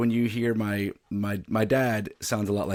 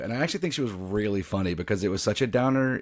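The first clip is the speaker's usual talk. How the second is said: normal talking voice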